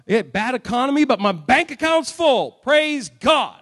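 loud, crowing mock praise; mock jubilant boast. Well-off believer praising God over bank account, mock jubilant boast. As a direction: boast it out like a celebration.

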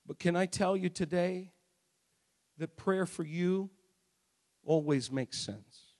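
low, soft, with long pauses; gentle pastoral appeal. Acted. Preacher comforting troubled people in the building, gentle pastoral appeal.

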